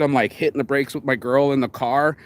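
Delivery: ordinary talk, nothing marked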